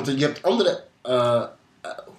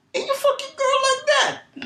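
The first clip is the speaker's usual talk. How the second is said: squeaky joke voice. Acted. Crude comic punchline, voice jumps.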